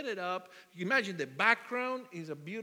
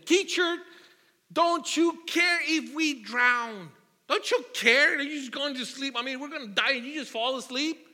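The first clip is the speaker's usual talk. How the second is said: louder, higher panicked plea to the teacher; frantic disciples; 6 semitones higher, 8 dB louder than usual. Retold or imitated. Disciples waking Jesus in the storm, loud high panicked plea. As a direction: plead in a panic as the boat sinks. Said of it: "and said to him".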